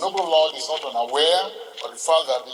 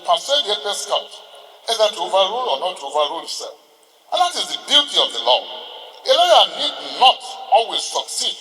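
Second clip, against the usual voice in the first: higher, louder declaiming of a quoted passage; raised courtroom recital of a judge's words; oratorical reading; 5 semitones higher, 4 dB louder than usual. Acted. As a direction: read the quotation out to the court like a grand speech. Reads quoted foreword aloud to court.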